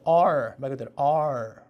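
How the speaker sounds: R drawn out slowly, tongue not touching; R said slowly, tongue barely grazing the palate; slow English R. Shown as a demonstration. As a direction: hold the R long and curled, no tap. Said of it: "약간 다, 다".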